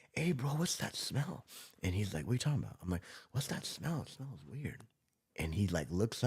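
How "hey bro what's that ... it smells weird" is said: hushed question. Retold or imitated. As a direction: ask the partner under your breath, unsure. New guard quietly asking partner.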